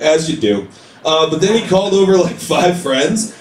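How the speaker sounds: normal talking voice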